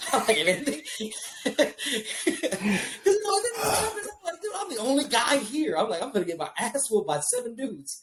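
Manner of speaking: high, laughing through the words; can't stop laughing while telling it. Real, not acted. Laughing at his sister's friends.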